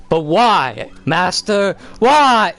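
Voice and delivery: high, loud, rising bratty whine; whiny protest. Retold or imitated. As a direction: whine it like a sulky teenager at his master. Anakin whining at Obi-Wan.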